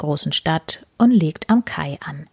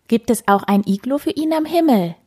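eager cub; 7 semitones higher than usual. Acted. Excited cub asks a question.

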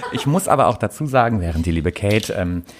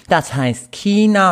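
higher, slow, correcting teacher; mock the English teacher's slow correction; strict teacher; 7 semitones higher, 4 dB louder, much slower than usual. Retelling the teacher's correction in class, slow and high. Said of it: "eine Englischlehrerin von mir tatsächlich gesagt".

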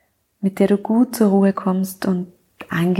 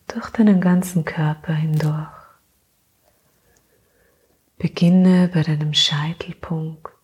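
sleep meditation; 2 semitones lower than usual. Acted. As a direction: gently lull her into sleep.

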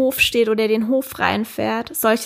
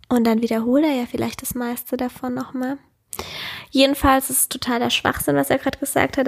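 ordinary talk, nothing marked

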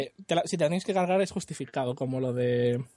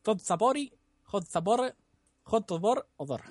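hammed-up Russian accent, higher; mock Russian accent; 4 semitones higher, slower than usual. Showing off his Russian accent to friends, hammed-up Russian accent. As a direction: roll it out in a joke Russian accent. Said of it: "atento a mi acento ruso".

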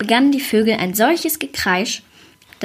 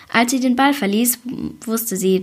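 usual speaking voice

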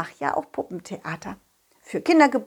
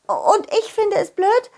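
put-on high fairy child voice; 10 semitones higher than usual. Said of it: "Und dann legte sie los".

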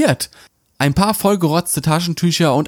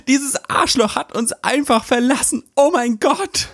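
put-on high whiny outraged girl; dramatic wail; 10 semitones higher than usual. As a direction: wail it to the girls. Wailing about breakup.

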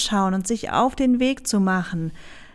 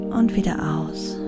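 breathy whisper on the exhale; soft spa whisper; 5 semitones lower, 5 dB quieter than usual. Acted. Breathing cue in meditation. (gehauchtes Flüstern)

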